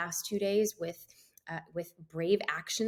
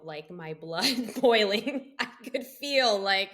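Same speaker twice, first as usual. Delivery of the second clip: laughing mid-sentence; 7 dB louder than usual. Real, not acted. Retelling anger, laughing at herself.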